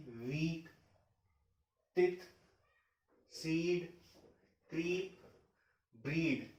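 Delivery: long stretched ee in वीक, सीड, क्रीप. Shown as a demonstration. Teacher demonstrating long ee to students. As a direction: draw out the ee sound in वीक and सीड. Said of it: "इसे stretch करते हुए बोलिए".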